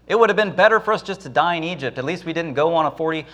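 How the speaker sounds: high, fast complaining whine; whining Israelites. Acted. Grumbling Israelites wish they had stayed in Egypt, high whine. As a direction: moan as if the trek were ruined. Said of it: "They wanted to go back to Egypt".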